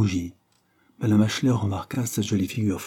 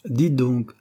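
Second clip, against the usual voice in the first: higher-pitched call with lordly airs; lordly, slightly patronizing; 5 semitones higher, 4 dB louder than usual. A narrator's reading. Henry hails William as he leaves, slightly patronizing. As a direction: call out with mild condescension. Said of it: "cria Henry d'un ton aristocratique et légèrement protecteur".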